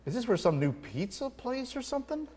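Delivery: mock bewildered listener; baffled thought. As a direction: puzzle it out in disbelief. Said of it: "I'm thinking".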